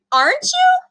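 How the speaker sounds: put-on hurt boy begging his friend; pleading echo. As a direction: ask it again, desperate and high. Boy echoes plea much higher.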